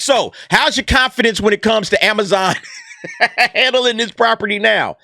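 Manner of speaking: ordinary delivery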